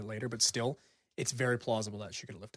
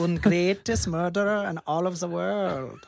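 put-on slow, loud, high German-accented boast; mock German accent; 5 semitones higher, 5 dB louder, slower than usual. Host playing the murderer to make co-hosts laugh, mock German accent. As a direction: draw it out as a hammed-up German killer. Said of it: "he had that accent".

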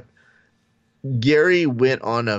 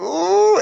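high, loud whimpering sob; hammed-up teary wail; mock sob; 6 dB louder than usual. Host hams up Rashad's teary reaction with a high wail.

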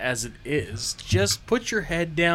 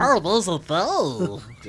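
creepy creature voice; 3 semitones higher, much slower than usual. Acted. Doing a goblin voice.

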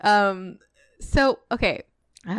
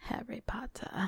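eerie unvoiced whisper; 2 semitones higher, 13 dB quieter than usual. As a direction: breathe the name like a phantom. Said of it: "it whispered".

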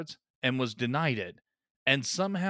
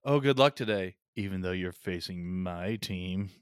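mock passive-aggressive good wishes, sinking lower midway; 7 semitones lower than usual. Snape needling Harry before the match, passive-aggressive. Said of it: "passive-aggressive fuck with him".

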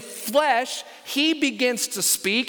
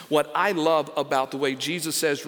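usual speaking voice

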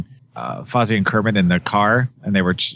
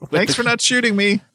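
high, thin put-on guard voice; mock frightened guard. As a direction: squeak it out like a timid guard. Quoting Stanley Tucci's guard.

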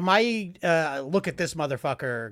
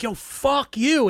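mock yell; 10 semitones higher, 4 dB louder, much slower than usual. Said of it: "And I was like".

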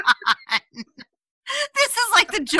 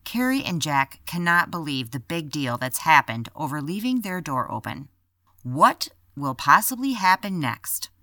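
podcast teaser narration; 10 semitones lower, 7 dB quieter than usual. Acted.

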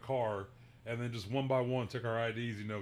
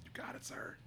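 mock whispered yes sir; 14 dB quieter than usual. Retold reply to the cop.

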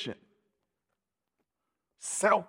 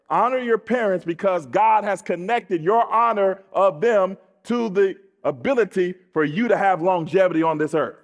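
normal talking voice

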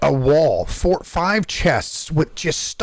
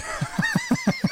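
high, soft giggle; real laugh; 7 dB quieter than usual. Real, not acted. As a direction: giggle at your own prank. Laughs at his own prank story.

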